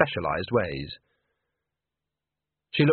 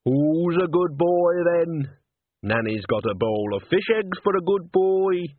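coaxing a cat; 6 semitones higher, slower than usual. A narrator's reading. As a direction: coo to the cat under the dresser.

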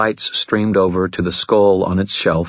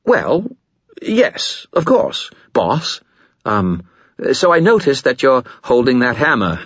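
stammering fear; 5 semitones higher than usual. Acted. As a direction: stammer out the plea. Nervous skull.

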